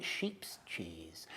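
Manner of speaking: breathy savouring whisper of cheese. A narrator's reading. Savouring the word.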